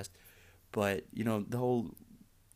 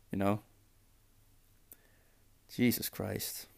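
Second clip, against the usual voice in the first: ordinary talk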